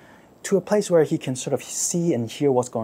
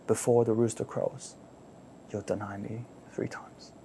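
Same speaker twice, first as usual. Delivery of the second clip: fading hush; 4 semitones lower, 8 dB quieter than usual. Acted. Prophecy repeated, fading.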